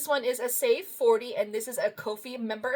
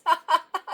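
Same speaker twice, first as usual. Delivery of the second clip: short laugh; 6 semitones higher, 5 dB louder than usual. Real, not acted. Laughing at a can of beans.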